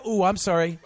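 ordinary talk, nothing marked

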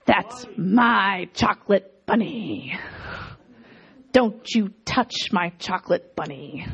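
put-on guard your candy like a little kid; mock child claiming the chocolate bunny; possessive kid. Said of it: "they'll be like".

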